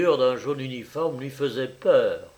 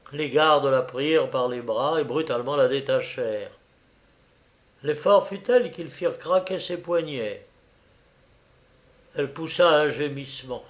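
usual speaking voice

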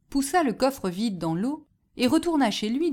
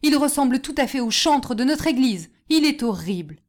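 loud, raised report of a fright; loud horror, settling at the end; horrified exclamation; 4 semitones higher, 6 dB louder than usual. A narrator's reading. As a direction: cry out what you saw. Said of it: "s'écria le paysan en faisant un bond".

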